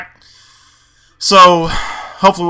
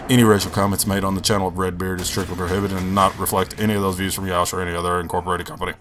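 much lower and softer, a deadpan legal voice; mock legal disclaimer; 11 semitones lower, 12 dB quieter than usual. Streamer faking a channel disclaimer in a low legal voice. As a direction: read it like the small print at the end of an ad. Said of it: "I'm gonna start doing fucking legal".

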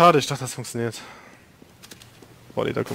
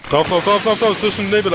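high, loud repeated urging; hyped rush call; 7 semitones higher, 4 dB louder than usual. Real, not acted. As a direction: drive the team forward. Rushing team through smoke.

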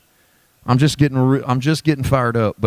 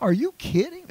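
high, soft incredulous question; amazed disbelief; 3 dB quieter than usual. Retold or imitated. Preacher imagining John amazed at God's love, soft and high. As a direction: marvel like you can't believe it. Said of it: "how I hear John".

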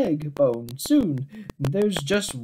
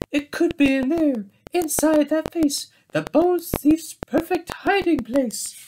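eager high guess; 10 semitones higher than usual. Acted. Bear guesses hiding place.

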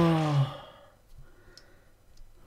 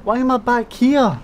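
louder, higher exasperated cry; frustrated outburst at being sent back; exasperated shout; 6 semitones higher, 9 dB louder than usual. Real, not acted. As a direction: throw the question out in exasperation. Frustrated outburst then repeat.

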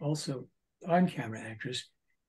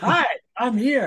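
mock cheerful arrival; 9 semitones higher, 10 dB louder than usual. Joking about turning up uninvited.

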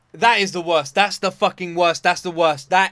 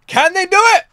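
much higher and louder rising question; hyped shout; 12 semitones higher, 10 dB louder than usual. Real, not acted. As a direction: belt it out hyped. Hyped repeat.

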